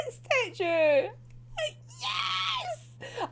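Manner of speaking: very high fangirl squeal; squealing joy. Real, not acted. Fan seeing her favourite return, very high squeal. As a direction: shriek with delight.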